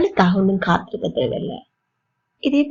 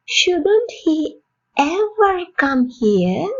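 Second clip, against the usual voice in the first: higher "Shouldn't", slow rising end; high questioning; 6 semitones higher, much slower than usual. Shown as a demonstration. Teacher modelling a doubtful question, high start. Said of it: "model question".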